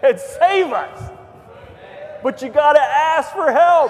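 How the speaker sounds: preacher shouting the call to ask for help; fiery preaching. Acted. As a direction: drive it home to the congregation. Preaching at full force.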